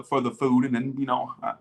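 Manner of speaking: ordinary talk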